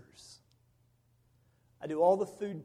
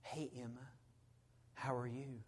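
put-on much softer, barely raised, gentle greeting to a child; very soft-spoken doctor; 4 semitones lower, 13 dB quieter than usual. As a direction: imitate the doctor's tiny quiet voice. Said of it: "he's talking like this".

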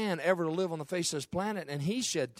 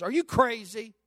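higher, louder disbelieving question; mock disbelief, pitched up; imitated incredulous wife; 5 semitones higher, 4 dB louder than usual. Acts out wife's incredulous reaction.

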